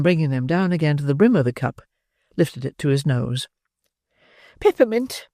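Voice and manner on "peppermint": faint matron. Acted. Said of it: "in a faint voice".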